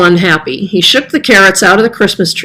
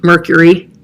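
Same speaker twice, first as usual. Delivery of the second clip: usual speaking voice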